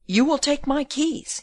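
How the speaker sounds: seething, incredulous anger; choking with rage. A narrator's reading. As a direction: confront her with bottled-up fury. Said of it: "in a voice choking with rage".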